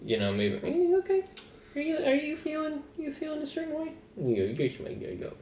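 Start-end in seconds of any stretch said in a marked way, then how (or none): 0.7-3.9 put-on high, soft, sing-song baby-talk question; high cutesy voice. Voicing a question to someone. 4.6-5.3 soft breathy whisper. Pretend. Voicing the answer back.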